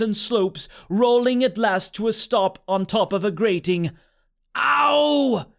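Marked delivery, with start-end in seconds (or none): 4.5-5.5 high, drawn-out cry of pain; pained yell. A narrator's reading. Boy lands hard on a grating, pained yell. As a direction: yelp from the shock of a fall. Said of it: "Joshua yelled".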